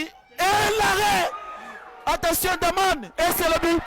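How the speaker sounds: excited shout. Real, not acted. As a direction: yell the save, then the goal. Save then goal, live call.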